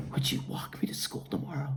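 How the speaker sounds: soft whispered plea, reconciled; tender whisper. Retold or imitated. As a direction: whisper it gently, making peace. Girl's tender whispered request.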